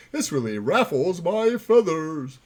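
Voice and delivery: ordinary talk, nothing marked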